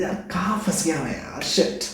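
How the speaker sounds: mock muttered frustration; a soft, fed-up grumble to yourself; feeling stuck. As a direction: act out being stuck somewhere awkward. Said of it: "inappropriate feel करोगे".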